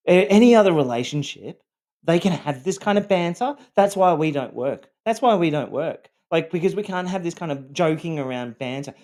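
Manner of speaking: high, loud imitation of a nagging complaint; retold exasperated scolding, pitched well up; scolding partner. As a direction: berate someone as if it's all their fault.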